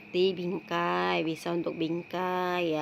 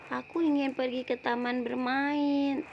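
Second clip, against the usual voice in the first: little brother answering; 7 semitones higher than usual. Acted. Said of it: "Lalu adiknya pun menjawab".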